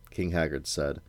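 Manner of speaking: ordinary delivery, nothing marked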